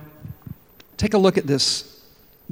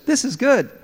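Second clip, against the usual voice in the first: cheerful catchphrase; 8 semitones higher than usual. Acted. Friend upbeat at stump.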